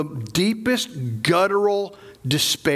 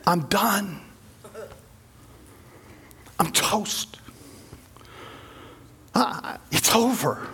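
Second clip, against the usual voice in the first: hushed, slower, resigned mutter; mock defeat; 5 dB quieter, slower than usual. Will Smith facing the invasion, quietly giving up. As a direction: give up quietly, all hope gone.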